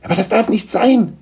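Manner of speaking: high-pitched lament; wailing. Acted. Gnome fears losing his home, wailing. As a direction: wail it in protest. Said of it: "jammerte Schlammupf".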